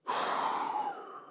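long, drawn-out exhale; disguised breathlessness. Acted. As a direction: let out a long breath, passing it off as natural. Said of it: "trying to keep it natural".

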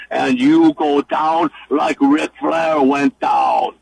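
a hint of a menacing Russian wrestling promo; slow Russian-accented threat, building to a shout. Cutting a promo on Bino in a Russian accent. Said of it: "in your best Russian accent".

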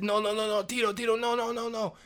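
high, loud string of frantic cries; panicked warning. Retold or imitated. Janitor stopping Tito drinking the bleach, high panicked shouting.

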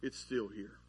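low, soft confiding aside; quietly in their ear. Acted. Disciple easing over to a non-listener's ear, murmured low. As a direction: ease in close and murmur it low. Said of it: "just ease over to their ear and go".

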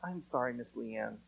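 a lightly voiced little girl apologizing to Leanne; meek apology. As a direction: say sorry with your head down. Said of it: "Hannah drops her head and says".

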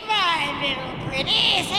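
witch voice. Pretend. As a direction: croon it like a wicked witch. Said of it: "can we get a witch laugh for the podcast".

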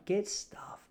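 hushed, unvoiced put-down; whispered aside. Real, not acted. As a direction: breathe it out under your breath. Muttered aside about wine price.

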